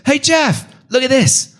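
high-pitched calling out, no real volume; mock yell. Co-host calls to host in the truck, mock yell. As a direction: imitate a friend yelling from the driver's seat. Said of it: "he yells out".

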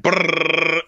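high buzzing lip-roll; imitated knee-drumming trill. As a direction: rattle it like a jiggling knee. Imitating knee drumming under baby.

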